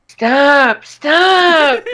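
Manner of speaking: high, strained cry of stop; high pitched strangled yell; strangled Percy yelp. Retold or imitated. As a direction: squeak it out like a panicked engine. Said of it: "all that came out was".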